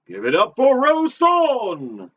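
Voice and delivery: slow, loud, high showman's intro; announcer shout. Acted. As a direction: bring her on like a game-show host. Host introducing co-host to audience.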